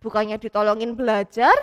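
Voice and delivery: ordinary delivery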